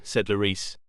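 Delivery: ordinary delivery, nothing marked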